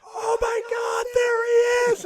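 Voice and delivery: high, loud terrified shriek; mock-terrified shout of a crowd of kids; kids shrieking in fright. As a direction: scream it like panicked teenagers. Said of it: "all the kids are freaking out".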